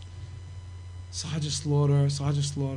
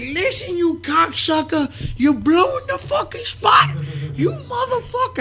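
very high, stretched-out furious shouting; enraged yell; 13 semitones higher, slower than usual. Retold or imitated. Promoter yelling about the blown spot, high furious shout. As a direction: chew him out in a shrieking high imitation. Acting out Sparta's outburst at wrestler.

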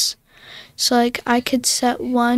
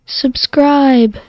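loud recorded playback; sample played back; playback sound; 8 dB louder than usual. Shown as a demonstration. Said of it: "the one I just did".

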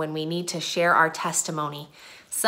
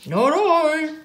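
high, drawn-out dog voice; storybook dog; 8 semitones higher than usual. A narrator's reading. Dog refuses to help water, high character voice. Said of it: "said the dog".

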